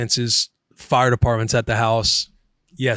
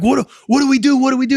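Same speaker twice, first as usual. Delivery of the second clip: high, louder frantic pleading, re-enacted; panicking; 11 semitones higher, 4 dB louder, faster than usual. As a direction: act out helpless panic in an emergency. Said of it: "I'm panicking".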